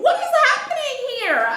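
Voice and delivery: high, loud alarmed demand; squealed outrage; shocked question. Retold or imitated. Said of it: "that's what I really said".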